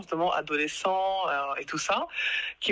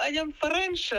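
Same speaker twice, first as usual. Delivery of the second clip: put-on Spanish-accented English; 11 semitones higher, slower than usual. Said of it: "parler anglais avec un accent espagnol".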